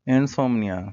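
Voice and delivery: انسومنیا proclaimed with a firm fall; word announced loudly. Shown as a demonstration. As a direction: announce انسومنیا like the next headword. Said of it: "انسومنیا، fall".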